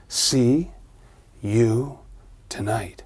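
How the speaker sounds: sinking to a whisper; fading ghostly threat. Acted. The ghost promising Danny a visit, fading to a whisper. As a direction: let the threat fade away.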